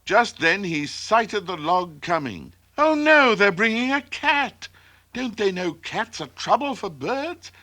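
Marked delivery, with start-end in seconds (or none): 2.8-7.5 put-on high-pitched alarm of a baby bird; panicky little bird. A narrator's reading. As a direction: squeal in dismay at danger. Chirp alarmed at seeing cat.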